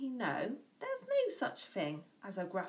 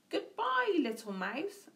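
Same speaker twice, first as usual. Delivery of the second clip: high quick farewell from the fox; 7 semitones higher than usual. A narrator's reading. Fox hurrying off from the mouse, high farewell. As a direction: squeak a hasty goodbye. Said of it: "Fox said".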